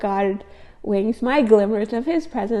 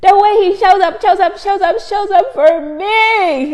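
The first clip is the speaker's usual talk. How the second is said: much higher and louder, building praise; overwhelmed praise; 10 semitones higher, 10 dB louder than usual. Real, not acted. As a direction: swept up, repeating it ever higher. Praising God's faithfulness, overwhelmed.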